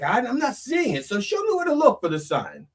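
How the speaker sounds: high, pleading voice of a man begging for a sign; high, pleading ask for a sign; pleading seeker. Retold or imitated. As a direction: beg God to show the way, pitched well up. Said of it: "And he's like".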